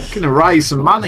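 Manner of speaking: put-on high, playful Aussie accent; hammed-up Australian accent. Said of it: "we've done a bad job there".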